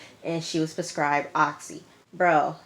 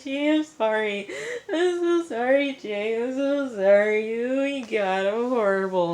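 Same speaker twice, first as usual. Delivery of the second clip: high, pleading sobbing plea, much higher; imitating a tearful apology, voice up high; mock crying apology; 7 semitones higher than usual. Alexa crying to Jay after overdosing, high sobbing apology. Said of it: "She's crying".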